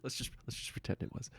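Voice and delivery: breathy, half-voiced sheepish aside; hushed aside. Real, not acted. Guest admitting a doubtful word, hushed. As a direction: let it slip out under the breath.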